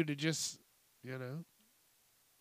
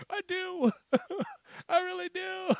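much higher, giggling through the words; laughing confession. Real, not acted. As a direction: admit it while cracking up. Laughing admission of jealousy.

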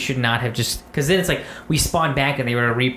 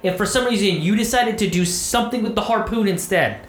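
much higher, heated rant at a teammate; frustrated rant; 8 semitones higher than usual. Retold or imitated. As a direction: chew out the buddy who blew it. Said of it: "I'm like".

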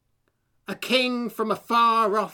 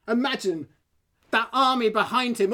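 ordinary delivery

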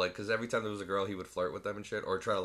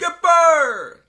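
high, very loud yell; calling out; 17 dB louder than usual. Acted. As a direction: holler it like a hammed-up sitcom call. Calls out as castaway character.

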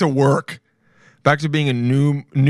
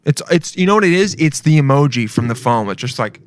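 ordinary delivery

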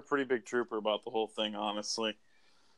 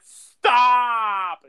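sharp, high, loud cry of stop; mock bystander yelling stop at a brawl; imitated yell; 10 semitones higher, 8 dB louder than usual. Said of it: "people yelling in the background".

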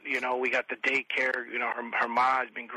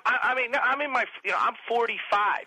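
high-pitched worked-up protest; comic exasperation; exasperated rant; 9 semitones higher than usual. Real, not acted. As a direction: plead your case, voice pushed up high.

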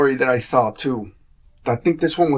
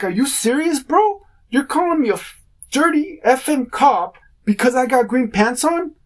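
retold outrage; 7 semitones higher than usual.

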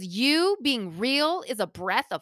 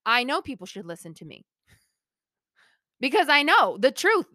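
self-assured; faster than usual. Real, not acted. Host answering her old doubt among close groups, self-assured.

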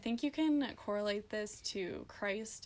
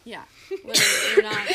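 laughing; 12 semitones higher, 15 dB louder than usual. Real, not acted. Laughs through reply.

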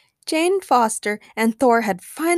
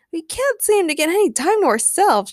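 higher-pitched, exasperated complaint; complaining; 6 semitones higher than usual. A narrator's reading. Jane to Thor after another interruption, complaining. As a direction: whine a little, pitch swooping. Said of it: "she complained".

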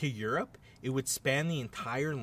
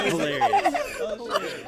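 laughing; 5 dB louder, much slower than usual. Real, not acted. Laughs at caterpillar accent joke.

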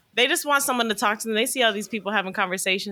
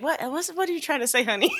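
put-on talking to a kid; 6 semitones higher than usual. Adult puzzled by a kid's babble, coaxing kid-talk voice. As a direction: gently coax words out of a toddler. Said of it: "you ever talk to a kid".

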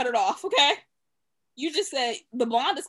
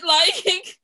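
laughing cry; 5 semitones higher, 6 dB louder than usual. Real, not acted.

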